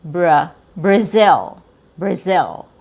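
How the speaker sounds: "Brazil" as bruh-ZIL; Z to short I. Shown as a demonstration. Teacher repeating the natural version, Z sound. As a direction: glide from buzzing Z to lax I in "Brazil". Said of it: "from a Z sound going".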